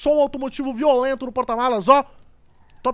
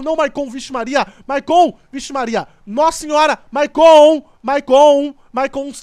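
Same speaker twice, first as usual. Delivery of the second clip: loud, alarmed calls of a name; calling out loud; 8 dB louder than usual. Real, not acted. Gamer yelling at Maicon as the car drives into the river, loud alarmed calls. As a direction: yell at him to stop the car from sinking. Yelling at character driving into water.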